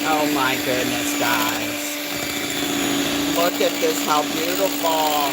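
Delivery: loud, thrilled exclamations over the cake; excited gushing. Real, not acted. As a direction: gasp it out like you can't believe how good it looks.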